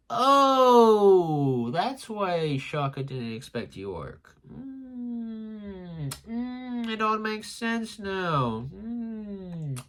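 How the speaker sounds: high, drawn-out mock epiphany; dawning realization. Hammed-up reaction of sudden realisation.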